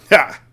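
loud derisive ha; a sharp mocking snort of a laugh; scornful snort. Headmistress scoffing at the teacher's request, loud scornful ha. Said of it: "snorted Miss Trunchbull".

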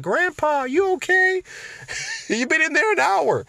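put-on high, childlike voice with a laugh breaking in; grandkid's voice. Grandkid finding grandpa stuck on the toilet, high childlike voice. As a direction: play the little grandkid checking on grandpa. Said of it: "your grandkid walks up and be like".

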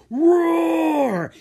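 huge, high, loud roar; dragon roar. A narrator's reading. Ice dragon roars.

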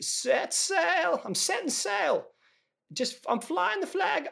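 very high, drawn-out triumphant cry; mock yell. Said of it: "he just randomly yells out".

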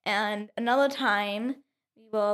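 normal talking voice

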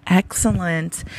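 ordinary delivery

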